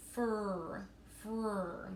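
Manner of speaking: "fur" drawn out as one held blend; stretched out. Shown as a demonstration. Teacher sounding out free for students, "fur" stretched. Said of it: "if I stretch it out".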